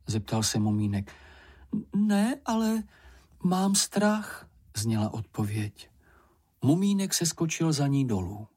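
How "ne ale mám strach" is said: high, small timid voice; frightened Ferka. A narrator's reading. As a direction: peep it out, a little scared. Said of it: "pípla slečna Ferka". (bojácný tenký hlásek)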